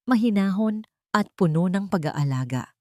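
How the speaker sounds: plain reading voice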